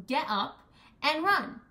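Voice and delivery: forceful command to move; urgent call. Acted. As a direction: rouse them to get up and run. Urging them to stop crying.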